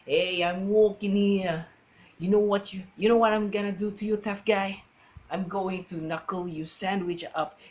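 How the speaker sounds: hammed-up New York wise-guy accent; New Yorker accent. Shown as a demonstration. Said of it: "With an Italian accent".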